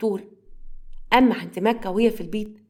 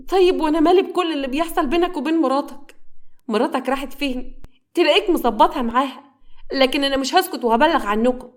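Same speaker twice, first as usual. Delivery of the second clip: high, louder indignant sister; angry confrontation; 6 semitones higher, 4 dB louder than usual. A narrator's reading. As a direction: lay into your brother at raised pitch. Sister rows with her brother. (خناقة بغضب وصوت عالي)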